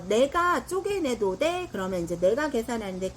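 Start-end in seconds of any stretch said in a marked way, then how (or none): 0.1-1.7 higher question. Pretend. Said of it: "어".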